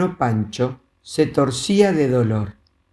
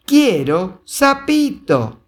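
put-on slow stubborn little girl; 7 semitones higher, 3 dB louder, slower than usual. A narrator's reading. Girl repeats demand.

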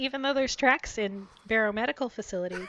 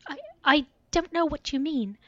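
higher, stammering, near tears; voice cracking; 7 semitones higher, 4 dB louder than usual. Acted. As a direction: denial with a cracking voice. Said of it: "Her voice cracked".

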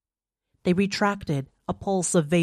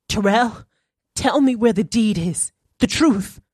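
higher and louder, urgent; desperate demand; 5 semitones higher, 5 dB louder than usual. A narrator's reading. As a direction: demand the truth. Natalie demands the truth.

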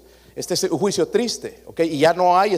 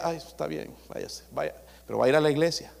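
soft, much quieter judge voice; a hushed, easygoing judge letting him go; lenient judge; 6 dB quieter than usual. Retold or imitated. Pastor imitating the judge to his congregation, hushed and easygoing.

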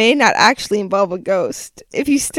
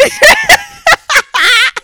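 high loud laugh; 6 dB louder than usual. Real, not acted. Laughs at own stumble.

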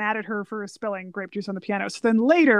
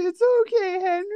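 mock comforting; 7 semitones higher than usual. Talking to the crying father on screen, high playful comfort. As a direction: console him like a friend.

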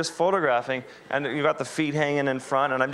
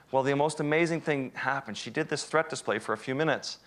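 normal talking voice

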